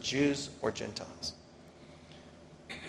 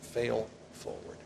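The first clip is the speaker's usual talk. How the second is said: slow, softer repeat of "Fail forward"; soft echo; 9 dB quieter, slower than usual. Shown as a demonstration. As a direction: let "Fail forward" sink in quietly. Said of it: "is what Pastor Kaiser says".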